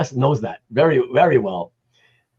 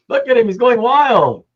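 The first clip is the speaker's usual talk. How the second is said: ordinary talk, nothing marked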